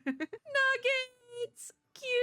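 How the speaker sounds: high, gushing squeal; delighted cooing. Real, not acted. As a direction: coo adoringly at a tiny pet. Coos at newly named pet.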